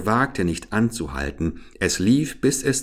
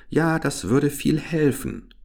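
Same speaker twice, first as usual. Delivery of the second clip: put-on old Finnish woman; 8 semitones higher than usual. A narrator's reading. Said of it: "sagte die Finnin".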